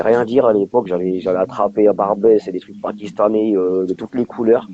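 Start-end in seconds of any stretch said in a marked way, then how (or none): none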